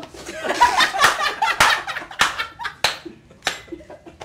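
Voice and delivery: high, loud burst of laughter. Real, not acted. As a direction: crack up at your own joke. Big laugh at own joke.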